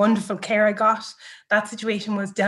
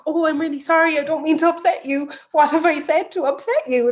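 higher, louder flustered apology; flustered apologetic care assistant; 7 semitones higher, 5 dB louder than usual. Retold or imitated. Care assistant apologising to the upset new mother, flustered and higher. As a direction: gush out apologies, flustered and a bit fast. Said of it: "she was like".

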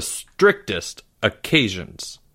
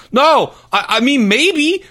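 put-on much higher, louder stammering boy; panicked backtracking; 4 dB louder than usual. A narrator's reading. Porge slips up before his teacher, panicked. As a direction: blurt it out, caught out.

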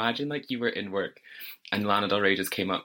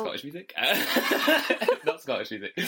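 giggling correction; laughing at own slip; 6 semitones higher than usual. Real, not acted. Catching her own slip mid-podcast, giggling. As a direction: laugh through the mistake.

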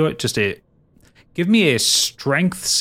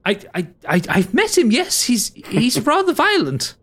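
much higher, stammering nervous voice; nervous Oropan; 10 semitones higher than usual. Acted. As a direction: stutter it, wary of the brute. Oropan flustered about killer.

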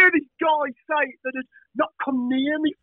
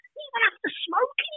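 mock disbelief; 13 semitones higher, 5 dB quieter than usual. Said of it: "he said".

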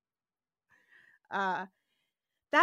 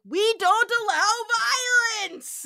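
high, loud hammed-up cry of protest; loud, high mock cry that fades out; mock wailing shout; 11 semitones higher, 11 dB louder than usual. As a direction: wail it like a scandalised elder. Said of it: "Aoborn's like".

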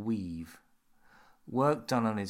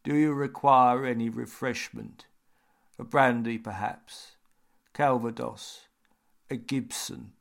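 creepy butler; 3 semitones higher, 6 dB louder than usual. A narrator's reading. Butler offering drinks.

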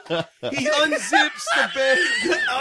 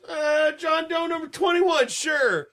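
higher, slower, casual doctor voice; lightly voiced doctor; mock doctor; 7 semitones higher, slower than usual. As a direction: read the tag off lightly like a bored doctor. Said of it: "He goes".

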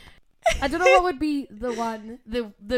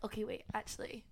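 soft self-correcting quote; 17 dB quieter than usual. Retold or imitated. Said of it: "you can quickly be like".

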